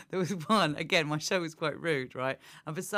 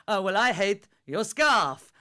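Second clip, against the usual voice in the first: slow, louder cheeky comeback; retold retort; 4 semitones higher, 8 dB louder, slower than usual. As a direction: hit back at the heckler. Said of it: "I went".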